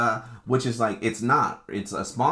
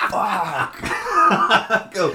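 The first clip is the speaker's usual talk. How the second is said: laughing curse; 10 semitones higher, 5 dB louder than usual. Retold or imitated. Retelling his own shocked reaction.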